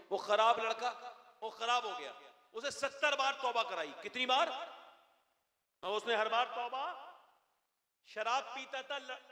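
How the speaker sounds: fervent pulpit storyteller; impassioned preaching. Acted. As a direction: preach it high and fervent, building the tale. Preacher tells story heatedly.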